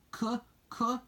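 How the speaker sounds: high, halting stutter of shock; sputtering. A narrator's reading. Ryan sees a dinosaur beside Lassie, high shocked sputter. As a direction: sputter in high broken syllables. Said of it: "he sputtered".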